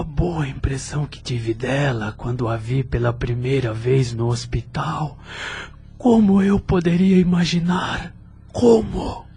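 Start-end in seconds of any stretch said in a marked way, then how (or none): 6.0-9.2 fighting back tears as the realisation hits; choked-up disbelief. Acted. Luciano thinking of Leonarda, voice breaking into a cry. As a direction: pitch rising and louder, breaking into a cry on the last word. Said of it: "esforçava-se para não derramar lágrimas".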